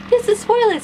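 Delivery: high, loud spoiler warning; mock announcement. Vlogger warning viewers of spoilers, high and loud. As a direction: call it out like a siren. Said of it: "but I'll be like".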